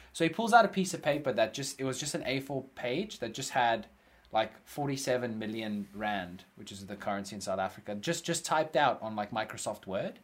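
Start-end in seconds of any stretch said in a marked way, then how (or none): none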